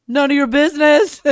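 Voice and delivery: put-on high, loud gleeful kid delivery; punchline. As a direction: belt out the joke's payoff. Child delivering joke punchline.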